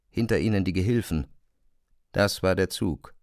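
ordinary talk, nothing marked

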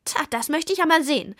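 quick venomous witch retort; hissed challenge. A narrator's reading. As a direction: fire it back angrily. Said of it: "zischte die Zwickurlaub".

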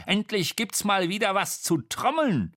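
high-pitched, gleeful drummer creature; high animated character voice; excitable Dommel. A narrator's reading. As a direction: pipe up high, eager to drum.

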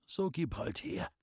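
soft, feeble consent; weak patient. A narrator's reading. Sick man agreeing to take his medicine, weak voice. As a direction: give in faintly, as if ill.

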